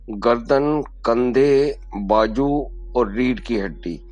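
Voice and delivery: calm guidance, slowing toward the end; guided meditation. Acted. Yoga teacher guiding breath to the spine, slowing meditative voice.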